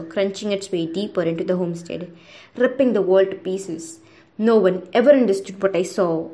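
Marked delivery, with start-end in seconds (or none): none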